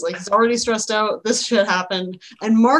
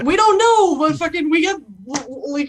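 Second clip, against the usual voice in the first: exasperated guard; 6 semitones higher, 3 dB louder than usual. Acted. Guard answering why they shoot birds, exasperated.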